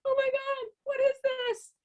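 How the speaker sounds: high, startled cries of disbelief; shocked Noah. Acted. Noah, finding a creature in the fire, voiced in shocked high alarm. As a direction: gasp it out as a panicked old man seeing fire. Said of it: "And Noah's like".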